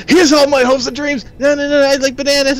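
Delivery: high, loud silly fangirl voice; mock the friend's awkward line; fast, high, loud goofy mimicry; mocking impression. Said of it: "would you come and go".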